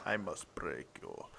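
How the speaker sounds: low, slow menacing Drago growl; deep, slow Russian brute threat; barbarian. Retold or imitated. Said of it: "echoing the Drago line".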